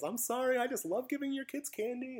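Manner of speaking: high-pitched pleading imitation of a woman; mock apologetic. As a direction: plead innocence in a put-on high voice. Candy lady excuses herself, imitated.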